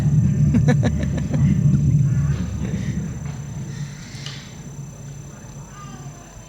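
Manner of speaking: breathy laugh. Real, not acted. Laughing at the mic joke.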